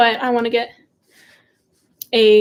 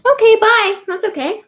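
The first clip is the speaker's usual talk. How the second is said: bright goodbye; 11 semitones higher, 6 dB louder than usual. Real, not acted. Waving off a viewer or pet.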